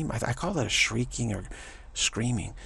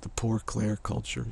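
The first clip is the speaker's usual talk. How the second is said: normal talking voice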